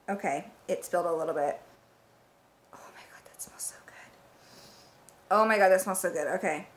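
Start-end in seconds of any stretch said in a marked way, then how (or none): 2.7-3.9 barely audible whisper of pleasure; hushed, unvoiced rapture; awed whisper. Real, not acted. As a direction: whisper it, savouring the smell. Overcome by smell, then repeats aloud.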